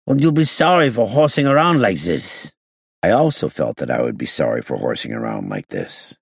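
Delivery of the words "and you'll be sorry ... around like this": put-on high, heated accented warning; indignant threat. A narrator's reading. Gangster warning the inspector he will regret it, high angry voice. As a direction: threaten shrilly in a foreign accent, TH said as Z.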